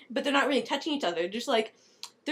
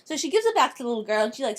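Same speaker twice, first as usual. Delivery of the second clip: ordinary talk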